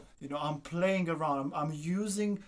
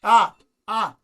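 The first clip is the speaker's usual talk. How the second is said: sudden loud startled "ah, ah" as the bow slips; startled cry; 4 semitones higher, 14 dB louder than usual. Real, not acted. Teacher startled as student's bow slips, loud alarmed cry. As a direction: jump in alarm, loud and high.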